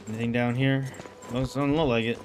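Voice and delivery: ordinary delivery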